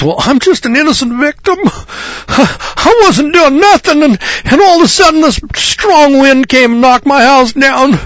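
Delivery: high, put-on whining victim voice; hammed-up high whine of a self-pitying homeowner; whiny innocent victim. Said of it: "he says".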